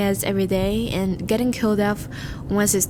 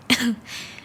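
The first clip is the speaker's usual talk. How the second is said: light laugh; 2 semitones higher than usual. Acted. Flattering his date at the door.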